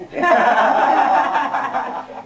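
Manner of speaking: high breathy laugh; real amused laughter. Real, not acted. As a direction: laugh out at the memory. Laughing at own story.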